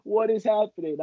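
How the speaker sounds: high-pitched imitation of a crying mother; hammed-up high, tearful questioning; mock tearful mom. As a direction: wail it as an upset mom. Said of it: "she's bawling her eyes out".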